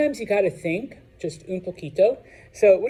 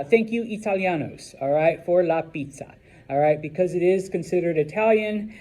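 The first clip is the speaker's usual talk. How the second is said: ordinary delivery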